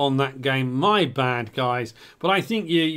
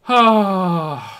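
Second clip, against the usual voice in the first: loud groan of dismay; 4 semitones higher, 9 dB louder than usual. Real, not acted. Exclamation of dismay.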